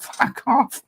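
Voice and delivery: high, laughing put-on Indian English punchline; mock Indian English retort. Recalling his chicken joke, laughs out the mock-accent punchline. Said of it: "[name] and the jokes".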